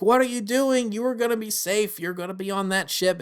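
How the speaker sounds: shocked she would stay behind; alarmed scolding. Retold or imitated. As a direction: scold the kid in sudden alarm. Imagined Ventress scolding the kid.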